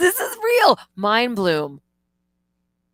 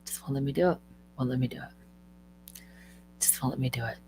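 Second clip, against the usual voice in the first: whisper; 7 semitones lower, 9 dB quieter than usual. Real, not acted. Muttering at failed video share.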